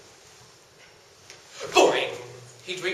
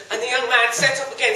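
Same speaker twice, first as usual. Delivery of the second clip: plain reading voice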